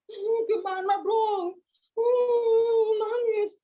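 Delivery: put-on high, drawn-out whimpering boy; mock crying. Boy riding home after the accident, mock crying. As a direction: wail helplessly in play. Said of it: "udah nangis di jalan".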